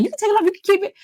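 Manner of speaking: very high, breezy mock agreeing; eager to please. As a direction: toss it off high and sweet. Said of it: "I'm like".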